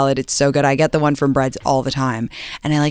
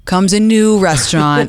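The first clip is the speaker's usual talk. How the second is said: mock announcement; 3 semitones higher, 4 dB louder than usual. Mock announcing new restaurant.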